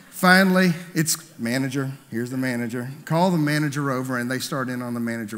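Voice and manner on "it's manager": ordinary talk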